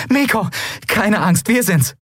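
high, happy reassurance; jubilant. Acted. Endriel telling the boy it's them, joyful high voice. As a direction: reassure with joy, pitch lifted. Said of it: "jubelte Endriel".

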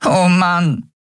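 high, near-crying sigh; a tiny fairy hiding her face in despair; dejected fairy. A narrator's reading. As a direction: let it crack toward a sob.

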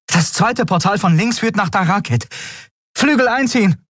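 high, rising to a shout; urgent command. Acted. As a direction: call the order across the cockpit. Pilot orders wings retracted.

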